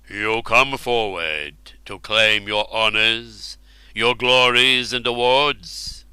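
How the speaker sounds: slow, grand royal voice; royal king voice. Acted. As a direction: speak slowly like a king. Ubar addresses hero.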